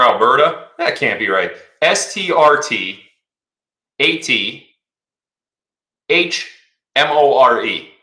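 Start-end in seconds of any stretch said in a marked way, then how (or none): 1.8-7.9 slow, deliberate letter-by-letter spelling. Shown as a demonstration. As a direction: read each letter out in disbelief. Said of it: "You're telling me that that's supposed to be Strathmore?".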